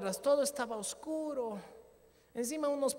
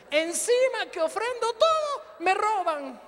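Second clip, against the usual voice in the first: loud high indignant complaint; loud, high-pitched outraged grumble; exasperated outburst; 11 semitones higher, 11 dB louder, slower than usual. Retold or imitated. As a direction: throw up your hands at being robbed. Exasperated at being robbed.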